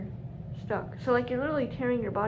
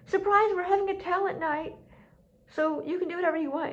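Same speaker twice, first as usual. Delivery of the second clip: retold cheery announcer, higher voice; excited announcement; 8 semitones higher, 4 dB louder than usual. Organisers springing talent night on the group, excited announcement. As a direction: imitate them springing a big surprise.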